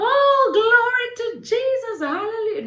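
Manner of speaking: high, loud praise cry; hammed-up hallelujah shout, very high; mock rejoicing. The joyful reaction she did not have, mock rejoicing. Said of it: "my first reaction was not".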